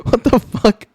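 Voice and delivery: high startled outburst; real disbelief, voice jumps up; shocked. Real, not acted. Reacting to sudden mood switch.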